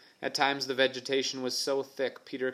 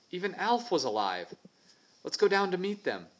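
elated; 7 semitones higher than usual. Acted. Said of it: "his heart soaring".

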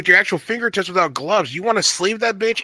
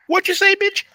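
shrieking high catfight voice; shrill overlapping reality fight. Retold or imitated. Linda's reaction voiced.

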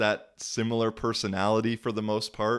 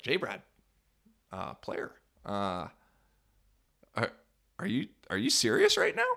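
mock incredulous coach. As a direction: act stunned he's being called out. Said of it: "He's like".

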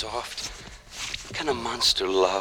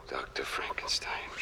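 whispering; 8 semitones lower, 12 dB quieter than usual. Acted. Monster addresses his maker.

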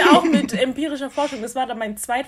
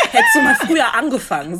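mock scolding; 5 semitones higher, 6 dB louder than usual. Scolding herself after the exam.

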